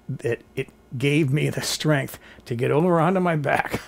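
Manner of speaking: usual speaking voice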